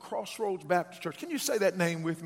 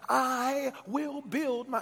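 slow, stretched words, left hanging; emphatic call-and-response; 3 semitones higher, much slower than usual. Shown as a demonstration. Preacher prompts congregation to finish Jesus's words, slow and emphatic. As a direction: draw it out and leave the ending open.

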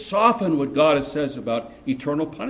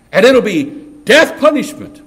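louder and higher, hammering it home; forceful preaching; 7 semitones higher, 11 dB louder than usual. Real, not acted. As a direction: drive the words out with full force. Preaching climax on judgment.

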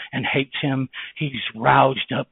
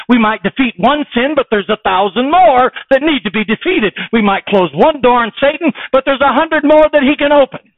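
high, loud, driving preacher's cry; preaching at full force; 10 semitones higher, 11 dB louder than usual. Acted. Preacher warning the congregation of Satan, loud and high. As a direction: hammer the warning home to the church.

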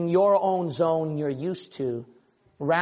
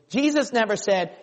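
normal talking voice

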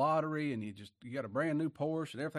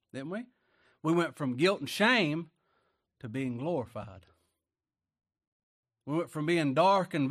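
fiery preaching; 3 semitones higher, 6 dB louder than usual. Acted. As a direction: thunder it from the pulpit. Preaching at full force.

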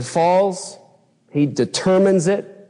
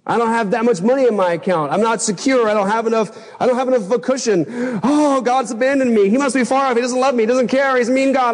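higher, fretful self-pity; mock anxious whining; 7 semitones higher than usual. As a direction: ham up your old panic about the bank account. Retelling his money panic.

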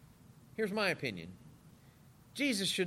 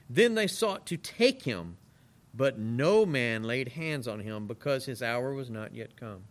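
slow, weighty Bible reading; scripture reading; 5 semitones lower, 4 dB louder, slower than usual. Acted. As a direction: read the verse slowly and solemnly. Reads scripture aloud.

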